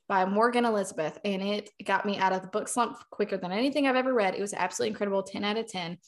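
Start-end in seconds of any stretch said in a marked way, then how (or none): none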